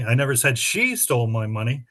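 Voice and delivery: "she" lifted in pitch; emphasize who did it, she; stress on she. Shown as a demonstration. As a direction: lean on the word she. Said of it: "read that sentence with seven different emphases".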